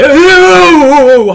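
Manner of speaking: very loud, high drawn-out "oh"; long yell of sudden alarm; startled scream. Real, not acted. Player caught off guard while driving, startled scream.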